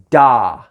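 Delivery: "Da." long with a loud accented attack. Shown as a demonstration. Teacher showing the accented jazz sound, loud. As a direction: hit "Da." hard at the start, then hold it. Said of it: "it's got an accent".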